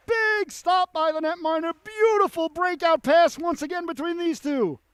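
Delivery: high, loud shouting play-by-play; excited save call. Real, not acted. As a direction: call both big saves at full throttle. Back-to-back saves, commentator erupts.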